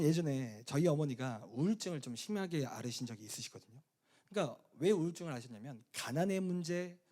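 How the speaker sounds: ordinary delivery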